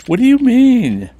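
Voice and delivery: surprised. Acted. Character reacting to accusation.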